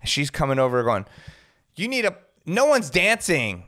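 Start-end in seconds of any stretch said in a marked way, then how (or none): 1.7-3.6 high, whiny mimicry of a pushy guest; mock nagging housewife. Reality star nagging the DJ, high mock whine.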